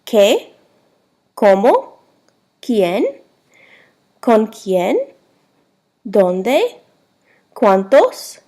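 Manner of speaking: each word from "¿Qué?" to "¿Cuál?" said singly with a steep questioning rise; Spanish question-word drill, "¿Dónde?" and the rest rising sharply, pauses between; pronunciation demo. Shown as a demonstration. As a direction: model "¿Qué?", "¿Cómo?", "¿Quién?" like a teacher, swinging up at each end. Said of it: "go over the pronunciations".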